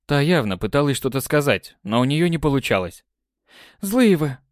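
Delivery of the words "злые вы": hurt outburst. Acted. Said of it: "выдала она".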